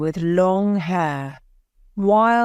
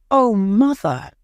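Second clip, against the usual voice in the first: mildly raised, higher exclamation; earnest cry; 4 semitones higher, 4 dB louder than usual. A narrator's reading. Bobby pleading with Mother, mildly crying out. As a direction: appeal to Mother with a touch of urgency. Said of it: "cried Bobby".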